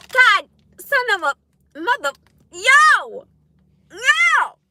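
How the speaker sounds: loud, high exasperated exclamations; heated outburst. Real, not acted. Worked up after venting about him, loud exasperation. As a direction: blurt it out loudly, pitch jumping up. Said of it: "That was a lot".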